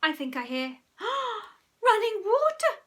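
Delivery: building from alarm to high, loud scream with a gasp; gasp, then shriek in panic. A narrator's reading. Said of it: "screamed Mum".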